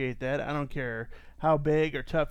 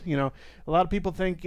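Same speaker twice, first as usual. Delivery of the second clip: ordinary talk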